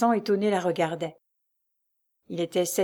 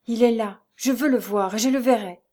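determined; 4 semitones higher, 5 dB louder than usual. A narrator's reading. Lucie resolves to see him.

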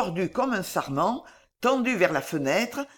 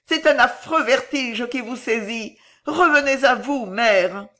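higher-pitched, tearful appeal; distraught pleading; 5 semitones higher, 5 dB louder than usual. A narrator's reading. Girl begging her delirious mother to come round, distraught. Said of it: "sanglota la jeune fille éperdue".

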